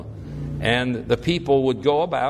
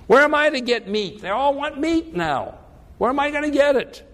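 exasperated Moses; 9 semitones higher than usual. Acted.

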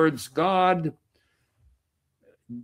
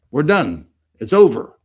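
We're done said louder, punching done; emphatic loud repeat; 8 dB louder than usual. Real, not acted. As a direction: drive it home, louder.

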